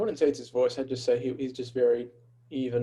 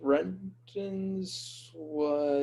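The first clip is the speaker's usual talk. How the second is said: words stretched out very slowly, higher pitched; stalling to recall; 5 semitones higher, much slower than usual. Real, not acted. Co-host trying to recall Brendan's guarantee, drawn-out stalling. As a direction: drag each word out while searching memory.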